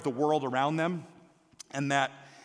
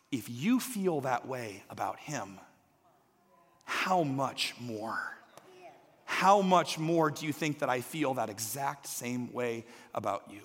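low, soft, slow reassurance; tender; 5 semitones lower, 4 dB quieter, slower than usual. Acted. God gently answering a worried parent, tender. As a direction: speak softly, like a loving father.

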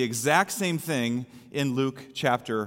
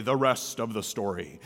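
low, softer radio storyteller sign-off; Paul Harvey; 7 semitones lower, 5 dB quieter than usual. Retold or imitated. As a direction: drop low for a famous broadcaster's tagline. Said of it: "As Paul Harvey would say".

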